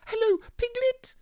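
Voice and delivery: very high squeaky little creature; excited squeak. A narrator's reading. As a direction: squeak it out like a tiny excited child. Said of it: "he squeaked".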